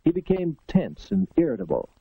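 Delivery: usual speaking voice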